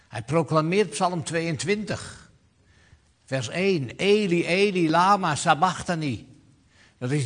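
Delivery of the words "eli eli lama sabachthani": "Eli, Eli" cried out loud and high; loud cry. Acted. Preacher voicing Jesus crying out on the cross, loud and high. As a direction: call it out with full voice from the cross. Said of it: "riep Jezus met luider stem".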